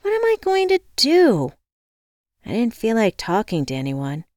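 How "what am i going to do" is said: high, loud helpless wail; whine. A narrator's reading. Locked-out woman wondering what to do, whining. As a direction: whine it plaintively, pitched way up. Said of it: "I whined".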